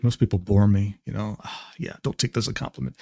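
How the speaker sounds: low, hushed mock-edgy voice dropping to a breath; edgy brooding guy. Streamer reading a guy's edgy DM aloud, mock brooding and low. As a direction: sneer it out like a try-hard loner. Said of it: "this edgy ass D M".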